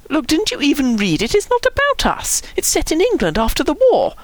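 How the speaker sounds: high, rising protest; worked-up, squeaky indignation; exasperated. A narrator's reading. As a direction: insist they have it all wrong. Nick exasperated, defending his book.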